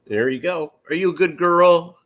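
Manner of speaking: high, loud doggy praise; talking to a dog. Real, not acted.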